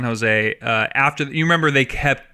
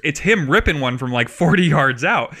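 ordinary talk

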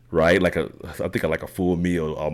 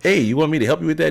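high, quick mimicked foreign accent; brightly imitating a helpful stranger's foreign accent; Paula Newby Fraser's accent; 9 semitones higher, faster than usual. Triathlete offering to zip his wetsuit, imitated in her accent. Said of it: "in this accent".

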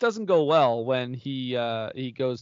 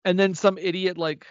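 ordinary talk, nothing marked

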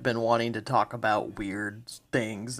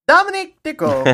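high, loud hammed-up Italian "Dominic De Coco"; Italian accent; 7 dB louder than usual. Friend doing a goofy Italian character, loud Italian accent. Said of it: "doing the Italian impression".

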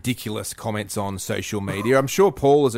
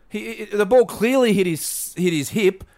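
fired-up rant; 6 semitones higher than usual. Acted. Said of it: "he's fired up".

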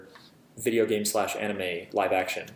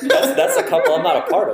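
laughing; 5 semitones higher, 8 dB louder than usual. Real, not acted. Laughs through her own quote.

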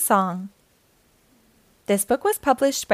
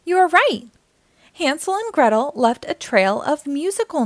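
bright, lively read-aloud voice; reading to children. A narrator's reading. Storyteller reading a picture book aloud to young listeners, lively and bright. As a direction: read it like storytime for little kids.

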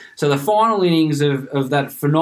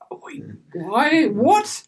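high, baffled disbelief; hammed-up confused outrage; incredulous; 10 semitones higher, much slower than usual. Baffled at bored friends.

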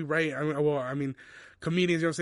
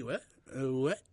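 [name] impression; 3 dB quieter, slower than usual. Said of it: "Quick Playboy Cardi".

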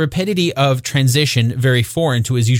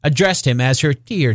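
usual speaking voice

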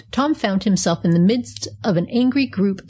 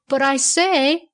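roaring; 7 semitones higher, slower than usual. A narrator's reading. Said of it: "roared the conductor".

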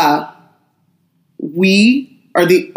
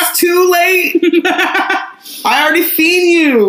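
high teasing voice; 12 semitones higher than usual. Retold or imitated. Teasing the younger brother.

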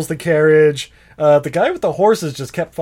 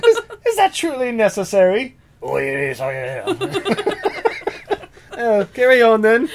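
prim onlooker; 7 semitones higher than usual. Acted. [name] plays posh onlooker.

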